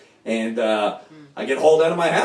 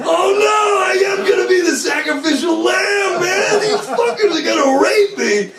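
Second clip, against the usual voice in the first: panicked; 3 dB louder than usual. Retold or imitated. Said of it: "And I'm like".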